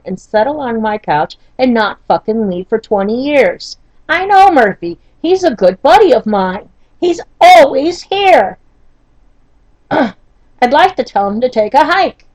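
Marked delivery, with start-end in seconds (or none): 4.1-8.6 higher, louder wry exasperation; exasperated. Real, not acted. Joking about Murphy's Law as an old buddy, exasperated. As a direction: vent with rising sarcastic emphasis. Exasperated joke about her constant bad luck.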